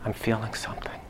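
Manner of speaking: breathy whispered patient line. Retold or imitated.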